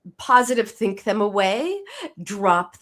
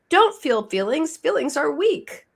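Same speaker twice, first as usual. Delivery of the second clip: "Feelings are weak" said in a higher mock-lecturing voice; first, lighter take of "Feelings are weak"; mock preachy culture voice; 7 semitones higher than usual. Host imitating society's message, a light higher mock lecture. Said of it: "our culture says two things".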